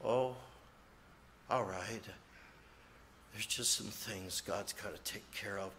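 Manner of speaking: burdened faraway murmur; distant and heavy. Retold or imitated. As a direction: murmur as if not talking to anyone. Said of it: "he just kinda stared off".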